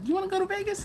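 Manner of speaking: high-pitched mock wife voice; imitating wife. Husband retelling wife's birthday trip idea, imitated high voice.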